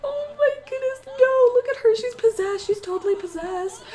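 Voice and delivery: high wail easing down gradually; tearful dismay. Real, not acted. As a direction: cry out in upset, pitch starting very high. Sees her Sim possessed.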